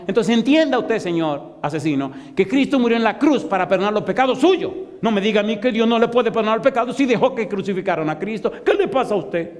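high, insistent acted scolding; re-enacted heated reproach; indignant rebuke. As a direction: set the man straight, pitch held high. Preacher retells rebuking the hitman.

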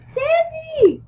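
very high, loud scream. A narrator's reading. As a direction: shriek the name at the top of your pitch. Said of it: "I screamed".

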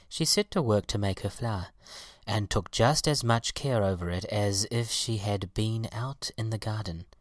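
ordinary delivery, nothing marked

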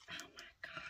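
barely audible amazed whisper; hushed awe; awed whisper. Real, not acted. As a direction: whisper in disbelief. Awed by the earrings.

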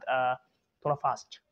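usual speaking voice

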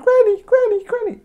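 very high calling out, fading at the end; girl calling for granny. Acted. Girl searching the house, calling granny very high. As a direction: call out like a little girl in the house.

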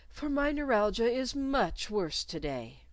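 fussy governess sounding a touch sorrowful. Acted. Governess Miss Royle speaking to her pupil, sorrowful.

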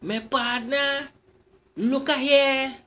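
high, loud teasing call; high loud beckoning call. Acted. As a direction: call after him down the road. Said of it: "she called out to me".

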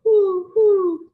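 high, loud hooting owl call. Acted. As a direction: hoot the words like an owl to the kids. Owl hoots in picture book.

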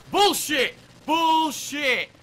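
loud, high-pitched yell of protest; furious at the game; angry outburst. Real, not acted. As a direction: shout it out in real outrage. Player rages at game death.

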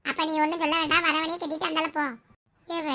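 normal talking voice

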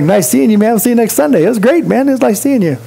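mock a churchgoer breezing past; breezy brush-off. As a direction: churchgoer dismissing someone after service, cheery and high. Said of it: "he's like".

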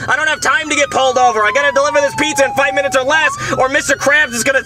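angry cop voice. Acted. Cop voice, angry at being pulled over.

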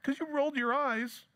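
very high, petulant complaint; mock hurt whine. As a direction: whine it like a sulky child. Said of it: "we have become so incredibly sensitive".

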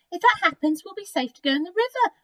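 a hint of a story-book pig; wild pig. A narrator's reading. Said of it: "grunted a wild pig".

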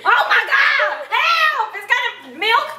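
high panicked cry for help; panicked shout. Acted. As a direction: scream for help in mock panic. Panic at kitchen fire.

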